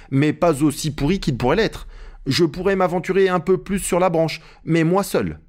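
Jupiter, bargaining servant. Acted. Narrator voices Jupiter replying. (Jupiter, voix légèrement jouée, plus aiguë)

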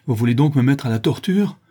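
angry cry. Acted. Captive brigand challenging his torturers, angry. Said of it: "avec plus de colère que de douleur".

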